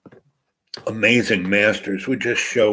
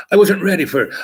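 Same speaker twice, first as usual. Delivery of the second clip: flustered protest; 7 semitones higher, 4 dB louder, faster than usual. Retold or imitated. Beaten hustler protests.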